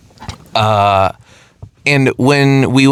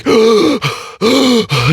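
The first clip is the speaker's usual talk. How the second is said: an acted sharp higher gasp for air; mock gasp; 9 semitones higher than usual. Acting out the choking woman coming back, a sharp gasp. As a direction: gasp as if breath came back.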